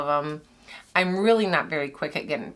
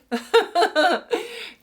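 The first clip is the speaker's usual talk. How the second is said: bright laugh; 10 semitones higher, 4 dB louder than usual. Real, not acted. Laughs after cheering.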